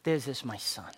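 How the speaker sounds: hushed Father's voice. Retold or imitated. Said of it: "What does the Father do?".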